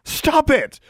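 high, urgent cry; cried out. A narrator's reading. Pinned girl tells the bully to stop, crying out. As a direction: cry out in protest, pitch leaping. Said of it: "she cried".

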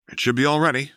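light friendly guy voice; lightly voiced Chuck. A narrator's reading. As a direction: pilot calling back from the controls.

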